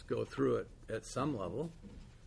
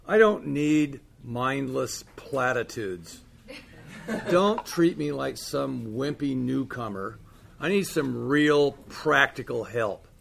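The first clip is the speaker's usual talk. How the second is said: loud, slow and insistent; angry retort; 9 dB louder, slower than usual. Retold or imitated. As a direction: push back hard, louder and slower.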